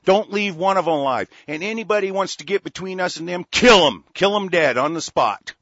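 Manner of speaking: loud, furious yelling at the listeners; angry shout. Real, not acted. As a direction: shout it out in real rage. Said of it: "I'm kinda pissed off".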